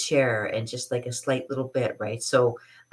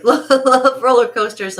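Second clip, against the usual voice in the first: high, loud, laughing love roller coasters; excited gush; 11 semitones higher, 11 dB louder, slower than usual. Real, not acted. Gushing to viewers about rides, high loud delight. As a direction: burst out with delight.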